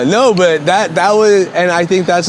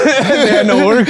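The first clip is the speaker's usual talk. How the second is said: laughing; 7 semitones higher than usual. Real, not acted. Laughing through words.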